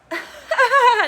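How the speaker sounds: high, loud burst of laughter; mock laugh. Monica seeing Josh in person, laughing in rejection. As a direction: laugh it off in a big imitated cackle.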